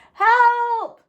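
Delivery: high, loud shout; calling for help. A narrator's reading. Jack stuck in the hole, shouting for help. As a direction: yell up from the hole. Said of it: "He shouted anyway".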